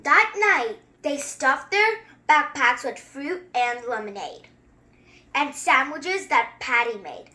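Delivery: measured, rhythmic read-aloud; a kid reading a picture book to viewers; storybook reading. Acted. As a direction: read it like a bedtime rhyme. Reads the book text.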